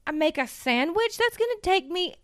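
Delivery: high, whiny, put-upon; whiny despair. Retold or imitated. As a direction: complain like you're helpless. Acting out hungover despair.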